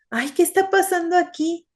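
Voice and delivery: a high startled gasp of a question; frightened girl. Acted. Marina finding the dark house, high and startled. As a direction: voice the girl's sudden fright. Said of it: "se espantó y dijo".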